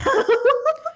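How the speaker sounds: high, loud giggle; high burst of laughter. Real, not acted. Laughing at mispronounced names.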